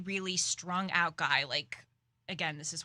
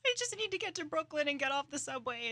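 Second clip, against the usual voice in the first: higher, fast nervous rush; anxious inner thought; 7 semitones higher, faster than usual. Retold or imitated. Sitting scared on the subway, thinking in a high fast nervous rush. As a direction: rush it like you're desperate to get off. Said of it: "because I was sitting there like".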